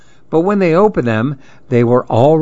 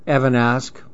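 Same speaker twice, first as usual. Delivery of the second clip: usual speaking voice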